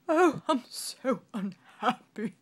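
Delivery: loud, slow wailing moan; hammed-up royal sob; sobbing king. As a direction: moan it through fake tears. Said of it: "he keeps sobbing".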